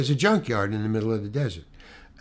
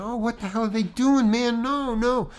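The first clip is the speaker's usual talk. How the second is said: yelling at the mirror; 10 semitones higher than usual. Retold or imitated. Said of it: "I'm yelling at the mirror".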